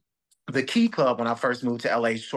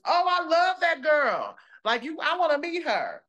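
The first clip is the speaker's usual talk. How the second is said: gushing fan imitation; 4 dB louder than usual. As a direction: gush loudly and high as the admirer. Acted quote of excited fan.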